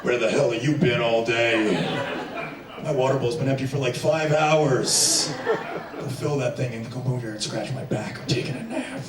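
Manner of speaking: a spoiled cat scolding its servant; bossy cat. Pretend. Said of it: "the cat's like".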